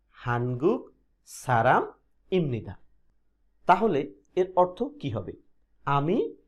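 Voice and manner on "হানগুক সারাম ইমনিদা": slow, careful হানগুক সারাম ইমনিদা; slow Korean model. Shown as a demonstration. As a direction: model the sentence slowly for learners. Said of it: "তাহলে কিভাবে হবে?". (ধীরে উচ্চারণ করে দেখানো)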